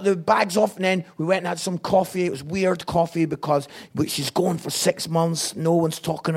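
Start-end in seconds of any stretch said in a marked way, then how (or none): none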